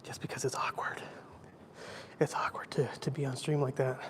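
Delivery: hushed breathy whisper. Real, not acted. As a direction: whisper so nobody nearby hears. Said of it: "I'm gonna be quiet".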